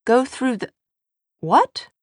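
high, disbelieving question; incredulous. A narrator's reading. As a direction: stop short in disbelief. Tessa stunned by his plan.

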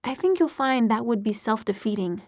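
ordinary delivery

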